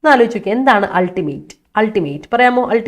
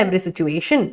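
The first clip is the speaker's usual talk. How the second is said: every situation said softer and lower; correct form, softer; 4 semitones lower, 6 dB quieter, much slower than usual. Shown as a demonstration. Teacher softly correcting Parvathy's phrase. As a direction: quietly give every situation, the fix. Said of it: "അല്ല".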